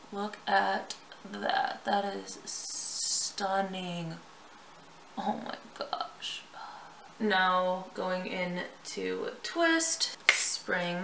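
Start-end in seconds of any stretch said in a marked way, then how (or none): 0.1-4.2 awed. Real, not acted. Admiring her finished eyeshadow. 5.2-6.4 slow, breathless whisper of wonder; awed whisper. Real, not acted. Admiring her finished eyeshadow.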